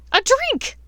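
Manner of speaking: loud high demand; cried out. Acted. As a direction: demand it at full voice. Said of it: "Bellatrix had cried".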